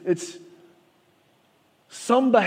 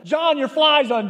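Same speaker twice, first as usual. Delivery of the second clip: blurted out; 8 semitones higher, 4 dB louder than usual. Retold or imitated. Friend finally blurting the problem out.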